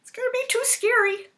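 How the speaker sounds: very high, loud fearful squeak; high frightened chick; scared little chick. Retold or imitated. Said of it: "the little chick said".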